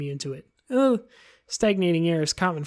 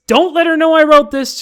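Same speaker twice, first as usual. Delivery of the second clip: suddenly louder and higher, urgent; frantic loud plea; 9 semitones higher, 12 dB louder than usual. Acted. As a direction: blurt it out in a panic. Natsuki's secret note voiced.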